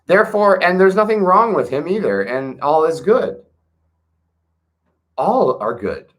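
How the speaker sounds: sarcastic jab at a politician. Acted. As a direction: sell it like an ironic all-clear. Mocks a president's defenders.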